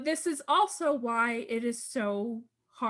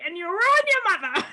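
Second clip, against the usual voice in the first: mock Boston accent, much higher and louder, half laughing; hammed-up Boston father snapping, cracking up; 9 semitones higher, 7 dB louder than usual. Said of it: "adopts this, like, Boston accent".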